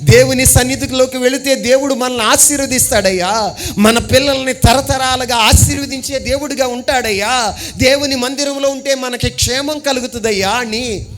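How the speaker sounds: preaching. Acted. Preacher exhorting the congregation, fervent. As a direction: preach it out to the church.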